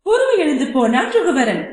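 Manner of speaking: ordinary delivery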